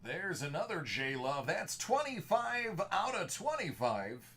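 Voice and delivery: big movie-trailer announcer read; projected movie voice. Acted. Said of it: "projecting the movie voice".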